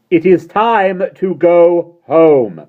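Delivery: slow, loud, high clarion call; trumpeting. A narrator's reading. Mary Poppins calling over the roaring laughter. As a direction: cut through the laughter like a trumpet. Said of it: "sounded like a trumpet".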